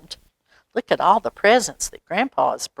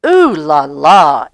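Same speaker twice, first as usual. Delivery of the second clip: delighted ooh la la; 3 semitones lower, 8 dB louder, slower than usual. A narrator's reading. Admiring the new topper.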